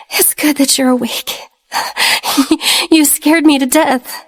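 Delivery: tearful, half laughing through tears; crying softly. Acted. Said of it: "said as she cried softly".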